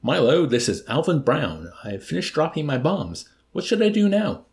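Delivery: pilot over the radio. A narrator's reading. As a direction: call in from the cockpit.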